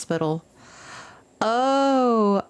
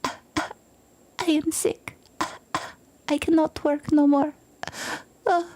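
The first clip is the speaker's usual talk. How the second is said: whispered, much higher feeble whine; fake sick voice; 8 semitones higher than usual. To the boss, fake sick whisper. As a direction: play dying to get out of work. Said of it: "look over at him and go".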